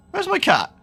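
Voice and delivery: high-pitched mock bewilderment; puzzled self-quote. Owner about to go to bed misses cat, mock puzzled.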